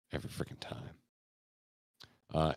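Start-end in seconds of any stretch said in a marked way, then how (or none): 0.1-1.0 whispered aside. Real, not acted. Aside about feeding his dog.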